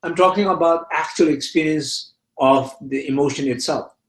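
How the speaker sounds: usual speaking voice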